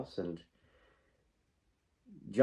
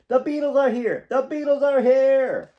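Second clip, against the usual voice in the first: excited yelling; 10 dB louder than usual. Acted. Child announcing the Beatles to the family, excited yelling. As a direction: holler the news through the house. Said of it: "ran to the living room yelling".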